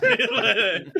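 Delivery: high, laughing imitation of the raptor calling Alan; mock raptor call. Quoting the raptor scene to friend, a high laughing call.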